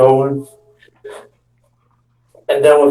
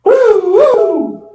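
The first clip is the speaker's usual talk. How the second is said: mock siren. Kid retelling the police chase, siren wail.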